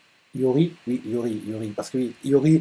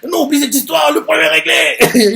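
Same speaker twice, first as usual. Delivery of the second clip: much higher, shouted rival line; fighting shout; 12 semitones higher than usual. Acted. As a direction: yell it mid-fight. Sanji fighting Zoro, yelling. (Sanji crie en se battant contre Zoro, voix bien plus aiguë)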